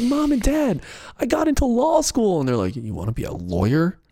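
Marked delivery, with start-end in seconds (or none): 0.0-2.4 high, hopeful announcement; eager young son. Pretend. David Blaine coming home to his parents, high and hopeful. 2.8-4.0 low creepy-magician parent; Blaine's parents. Acted. Parents disgraced by a lawyer son, low stern deadpan. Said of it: "then they talk like that too".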